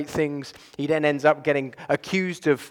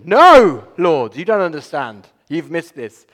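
loud protest; 11 dB louder, slower than usual. Pretend. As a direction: push back at God in a burst. Worshipper protesting to God.

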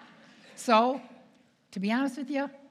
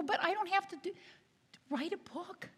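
high, reluctant whine; mock whine; 10 semitones higher, 5 dB quieter than usual. Nun protests to agent about the book, mock whine. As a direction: whine it softly and high, dragging out the end. Reluctant author whining, retold.

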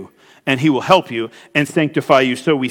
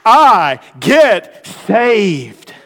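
emphatic drawn-out proclamation; 3 semitones higher, 4 dB louder, much slower than usual. Real, not acted. Preacher climaxes, drawn out.

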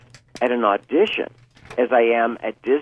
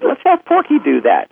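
studio exec. Retold or imitated. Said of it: "they decided".

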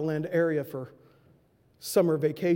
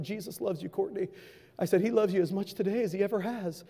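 softer, quiet comfort; tender reassurance; 5 dB quieter than usual. Retold or imitated. Preacher comforting grieving Courtney on phone, soft. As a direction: hush it down and reassure.

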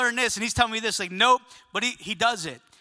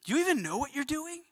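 softer, higher-pitched disbelief; incredulous question; 5 semitones higher, 6 dB quieter, faster than usual. Acted. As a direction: ask it plaintively, pitched up and held back. Repeats the doubting question to God.

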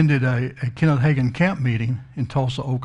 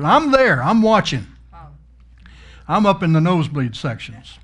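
excited. Real, not acted. Excited eyewitness claim.

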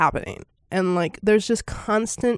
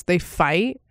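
usual speaking voice

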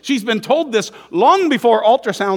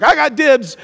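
put-on loud childish dibs claim; kid claiming dibs; 7 semitones higher, 7 dB louder than usual. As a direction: shout it like a bossy kid. Kids fighting over car seats.

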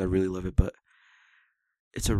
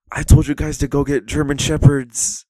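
loud, higher-pitched mock scolding; raised-voice comic reprimand; exasperated scolding; 6 semitones higher, 9 dB louder than usual. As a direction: play an annoyed boss berating his men. Acted scolding in film scene.

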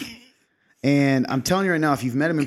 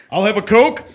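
higher, louder voice ordering a Coke; booming kingly order; 8 semitones higher, 9 dB louder than usual. Retold or imitated. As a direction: order like a king at the counter. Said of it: "he's got a kingly voice".

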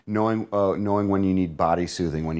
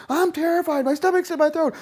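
terrified; 3 dB louder than usual. Acted. Acted client voice in dialogue.

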